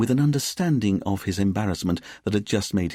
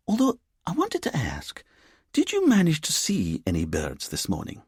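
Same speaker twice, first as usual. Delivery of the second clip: put-on lilting Edinburgh accent; 9 semitones higher than usual. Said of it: "in her lilting Edinburgh accent".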